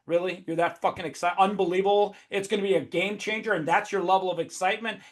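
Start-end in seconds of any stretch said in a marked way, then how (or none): none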